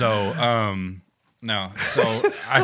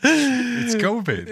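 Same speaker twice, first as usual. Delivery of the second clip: laughing; 13 semitones higher, 4 dB louder, much slower than usual. Pretend. Teasing chant of covid.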